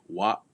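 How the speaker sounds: wa cut off in the throat; "wa" ending abruptly; glottal stop. Shown as a demonstration. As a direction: stop wa dead with a glottal catch. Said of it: "cuts off".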